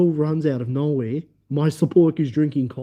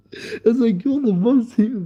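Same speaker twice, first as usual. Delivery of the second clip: high voice overtaken by laughter; laughing; 8 semitones higher than usual. Real, not acted. Storyteller praising his funny support worker, laughing. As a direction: squeeze the words out while laughing. Laughing too hard to speak.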